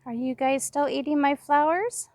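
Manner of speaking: high, sing-song pet talk. Real, not acted. Gardener greets her chickens in a high sweet pet voice. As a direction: coo sweetly at the hens.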